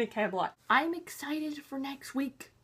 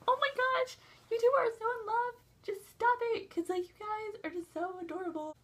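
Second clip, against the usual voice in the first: high-pitched, quick gushing; melting over an adorable couple, voice way up high; gushing fangirl squeal; 11 semitones higher than usual. Retold or imitated. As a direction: squeal it like you can't take the cuteness. Gushing over the lovey-dovey couple.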